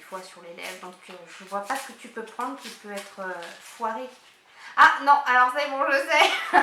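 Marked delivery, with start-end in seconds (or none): 4.8-6.6 loud laughing shout of recognition; laughing exclamation. Real, not acted. Laughing out loud as she recognises her friend's gift. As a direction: burst out laughing as you guess it.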